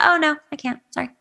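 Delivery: a quick apologetic no, acted out; imitating polite refusal. Guest imitating someone turning down a request. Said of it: "It's just like".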